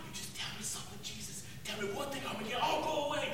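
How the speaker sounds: soft, breathy threat in his ear; menacing whisper. Retold or imitated. Soldier drops to menacing hush.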